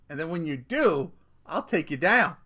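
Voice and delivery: usual speaking voice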